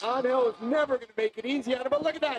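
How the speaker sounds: motorsport commentator. Real, not acted. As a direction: build up the overtake as it happens. Live race call.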